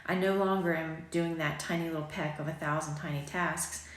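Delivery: ordinary talk, nothing marked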